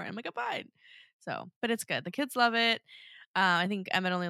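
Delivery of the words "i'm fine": retold high insistence. Retold insistence while crying at church.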